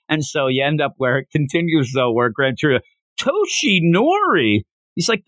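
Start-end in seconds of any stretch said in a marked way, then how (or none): 3.2-4.6 high, slow, stretched-out name; imitating a dotty elder's drawn-out query; mock confused old man.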